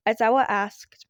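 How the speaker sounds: plain reading voice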